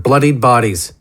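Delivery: ordinary delivery, nothing marked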